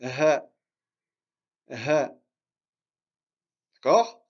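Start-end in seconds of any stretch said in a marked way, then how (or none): 0.0-2.1 Ha breathed out from the throat, winded; breathy ha. Shown as a demonstration. Teacher models breathless Ha for students. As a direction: let Ha come out as a breathless puff. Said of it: "c'est quand on est essoufflé".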